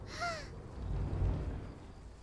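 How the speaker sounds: sharp airy gasp of wonder; a quick startled intake of breath; surprised gasp. Acted. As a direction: gasp at the spinning wheel. Wheel suddenly spins, amazement.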